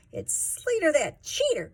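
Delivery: put-on loud, high upset child's cry; cried out loud. A narrator's reading. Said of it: "cried Blizzy out loud".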